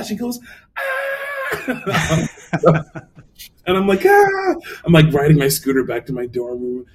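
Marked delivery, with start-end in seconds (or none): 0.8-2.3 high squealing shriek; imitated scream of delight; mom's excited scream. 4.0-4.5 high, loud squeal of joy; re-enacted giddy shriek; excited scream. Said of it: "I'm so excited".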